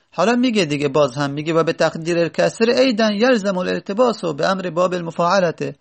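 ordinary talk, nothing marked

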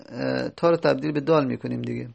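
normal talking voice

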